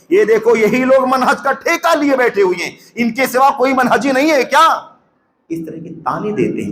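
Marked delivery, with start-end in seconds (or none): 0.1-4.7 higher-pitched, sarcastic imitation of critics; mocking taunt. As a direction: quote the critics with a scornful sneer. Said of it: "منہجی علماء کو کیا کہتے ہیں؟".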